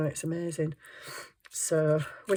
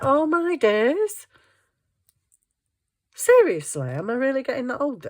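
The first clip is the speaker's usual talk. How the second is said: loud, high exasperated oh my days; outraged at your own body; dismayed exclamation; 8 semitones higher, 5 dB louder than usual. Retold or imitated. Exclaiming at back pain.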